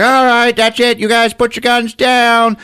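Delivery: high, loud shouted order; hammed-up police holler; mock cop command. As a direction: yell it like a cop taking charge. Cop orders gang, retold.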